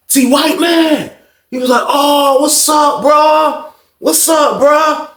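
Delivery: pumped reunion greeting; excited greeting. Retold or imitated. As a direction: voice the buddy who is thrilled to see you. Friend's enthusiastic greeting retold.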